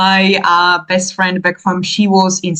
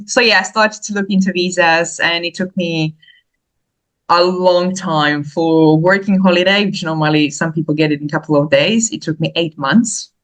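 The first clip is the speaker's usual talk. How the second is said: usual speaking voice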